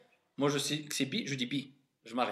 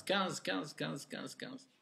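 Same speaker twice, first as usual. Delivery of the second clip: fading repetition; 11 dB quieter, slower than usual. Real, not acted. Repeating page number fading.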